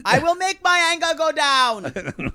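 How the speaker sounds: high, slow caricature voice; mock wailing impression. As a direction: draw it out high and silly. Echoes the silly imitation.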